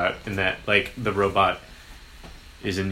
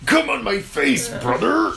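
high, loud wrestler shout; Hulk Hogan voice; 13 semitones higher, 4 dB louder than usual. Retold or imitated. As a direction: bellow it like a wrestling promo. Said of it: "That's Elf's catchphrase".

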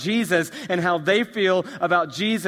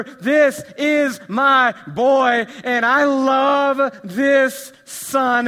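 vernacular; 9 semitones higher, 11 dB louder, much slower than usual. Shown as a demonstration. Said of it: "Can I just say it in the vernacular?".

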